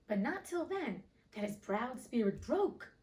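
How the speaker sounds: ordinary delivery, nothing marked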